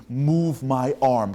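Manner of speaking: loud, very drawn-out order; straining command. Retold or imitated. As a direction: heave each word out slowly at full effort. Brain commanding exhausted body.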